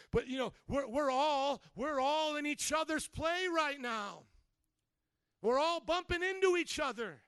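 loud, high, driving sermon voice; rising loud preacher's build; preaching at full force. Real, not acted. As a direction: preach it to the back row.

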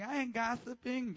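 defensive denial. Acted. Mimics indignant churchgoer's denial.

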